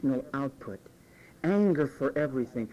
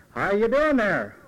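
louder, slower hello after a few drinks; louder tipsy greeting; 4 semitones higher, 6 dB louder, slower than usual. Retold or imitated. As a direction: call it out a bit louder and slower. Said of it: "Three or four".

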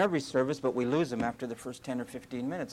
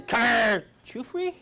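mock dog call; 8 semitones higher, 4 dB louder, slower than usual. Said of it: "I am not going out saying".

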